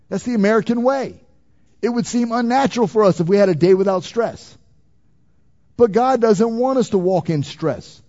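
high-pitched, emphatic preacher; forceful preaching. Real, not acted. Preacher urges a congregation to pray, forceful preaching.

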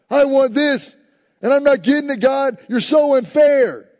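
put-on high, loud childish whine; whiny child. Pastor mimicking a churchgoer demanding from God, childish whine. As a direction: whine like a spoiled little child. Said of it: "Like a little child".

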